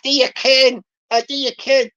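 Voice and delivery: a light put-on Fife Scots accent; cheeky Fife Scots accent. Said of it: "I've got to do my Fife voice".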